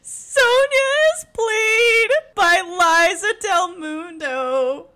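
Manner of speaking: high, loud, slow grand reveal; dramatic announcer. Pretend. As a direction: announce it like a gala. Overdramatic announcement.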